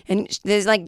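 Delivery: normal talking voice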